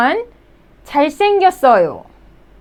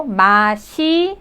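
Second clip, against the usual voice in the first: syllable by syllable; 3 semitones lower, much faster than usual. Shown as a demonstration. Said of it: "It sounds like".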